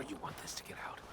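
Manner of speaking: menacing whisper; quiet threatening whisper. Acted. Veiled threat, hushed.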